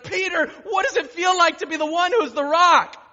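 loud, high reporter's shout; post-game interviewer. Retold or imitated. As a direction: call out like an excited sideline reporter. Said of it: "people come over with their microphones and they're like".

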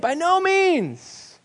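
"no" stretched high and slow; stress on no. Shown as a demonstration. Preacher to congregation, stressing "no" slowly. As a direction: lean hard on "no" in By no means. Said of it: "He's making an emphatic statement".

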